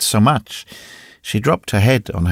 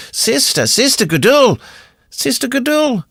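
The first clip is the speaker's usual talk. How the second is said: high, urgent calls; calling out; 13 semitones higher, 3 dB louder than usual. A narrator's reading. Oudard calling into the cell, high anxious call. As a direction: call her name, pitched far up.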